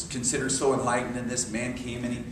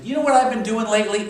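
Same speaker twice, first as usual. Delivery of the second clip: higher, louder, quick eager question; eager friend; 7 semitones higher, 7 dB louder, faster than usual. Retold or imitated. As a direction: lean in like sharing a juicy secret. Quoting friend Mary, excited.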